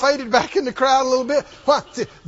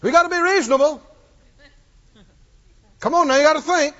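mock reasoning voice; 5 semitones higher, faster than usual. Mocking reasonable doubters.

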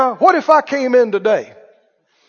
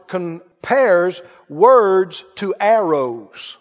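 slow emphasis; 3 semitones lower, much slower than usual. Shown as a demonstration. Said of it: "Have you read it?".

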